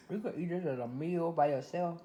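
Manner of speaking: usual speaking voice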